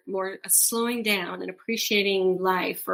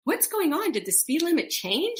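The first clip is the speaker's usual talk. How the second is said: higher-pitched, puzzled retold question; mock bewilderment; 9 semitones higher than usual. Driver passed by everyone on the road, acting out bewilderment. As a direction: act out being startled, pitch jumping up. Retelling surprise at being passed.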